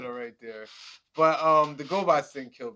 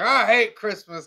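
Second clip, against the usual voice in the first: louder, higher, slow grumble as a Grinch; mock Grinchy outburst; Grinch-like quote; 7 semitones higher, 8 dB louder, slower than usual. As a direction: imitate a Christmas hater, drawn out and loud. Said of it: "kinda like a Grinch thing".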